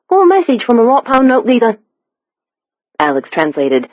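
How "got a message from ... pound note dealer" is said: high, thick Cockney; Cockney accent. A narrator's reading. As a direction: pitch it up as a London Cockney messenger. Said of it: "The stranger's Cockney accent was nearly impenetrable".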